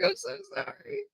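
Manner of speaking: very high, soft little apology; mock-meek apology. Apologizing again to the annoyed friend, mock-meek. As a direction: squeak it softly, playfully contrite.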